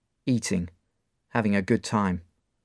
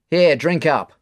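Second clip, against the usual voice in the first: louder offering; friendly bar owner; 6 semitones higher, 8 dB louder, slower than usual. Acted. Mark handing Dave a Coke: friendly offer. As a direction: push the drink over. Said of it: "Mark says".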